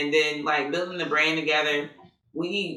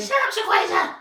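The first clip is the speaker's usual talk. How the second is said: high mock snap of the full name; mild imitated scolding. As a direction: playfully scold your sister. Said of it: "when she says my whole name".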